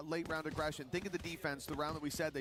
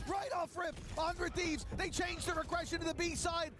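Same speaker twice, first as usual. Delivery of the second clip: much higher, building excited call; esports caster; 11 semitones higher than usual. Real, not acted. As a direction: build the hype as the play unfolds. Surprise teleport play, caster builds.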